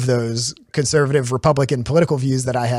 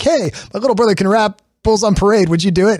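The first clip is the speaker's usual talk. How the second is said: put-on higher and louder, showing off a kid brother; excited big brother; 8 semitones higher, 4 dB louder than usual. Older brothers showing off the twelve-year-old, excited and higher. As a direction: hype him up like a party trick. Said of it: "they'd pull me out and be like".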